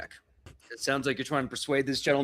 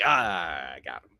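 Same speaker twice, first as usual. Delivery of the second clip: sinister grin; 5 semitones lower, 3 dB louder than usual. Acted. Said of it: "sickening Joker grin".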